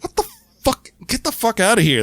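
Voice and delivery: high-pitched, indignant outburst without real volume; hammed-up annoyance; mock-irritated Michael Myers. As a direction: play at being rudely disturbed. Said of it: "Michael being like".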